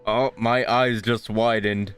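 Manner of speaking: usual speaking voice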